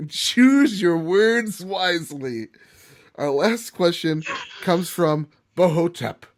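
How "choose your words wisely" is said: mock warning. Mock warning before final question.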